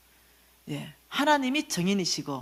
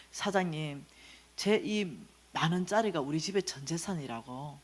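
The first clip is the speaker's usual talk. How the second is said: tearful plea; 4 semitones lower, 7 dB quieter than usual. Retold or imitated. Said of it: "그 눈물 펑펑 흘렸습니다".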